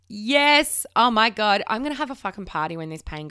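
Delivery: normal talking voice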